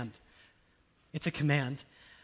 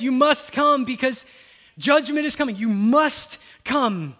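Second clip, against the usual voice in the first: louder, higher, slowed warning; stern warning; 6 semitones higher, 10 dB louder, slower than usual. Acted. Jesus warning of coming judgment, louder and stern. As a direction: warn them firmly.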